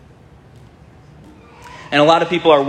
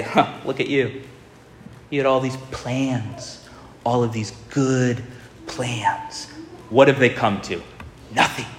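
mocking sneer; 5 dB quieter than usual. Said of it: "they mock him and say".

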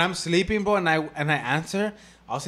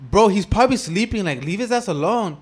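put-on louder, higher scolding girlfriend; acted exasperation; 5 semitones higher, 9 dB louder than usual. Girlfriend defending the sleeping friend, mock exasperated. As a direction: imitate a fed-up girlfriend butting in.

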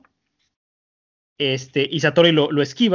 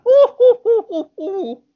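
shrill, very high hooting laugh; high, loud teasing hoots; mocking laugh; 7 dB louder, slower than usual. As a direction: cackle like a taunting monk. Villain Satori laughs mockingly.

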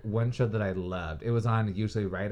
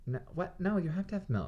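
shocked disbelief; 10 semitones higher than usual. Real, not acted. Shocked at cereal without milk.

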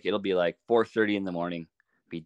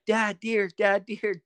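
imitating kids screaming; 12 semitones higher, slower than usual. Boys spotting a deer, shouting to dad, high mock yell. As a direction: mimic the boys' high yelps of excitement. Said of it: "both my boys start screaming".

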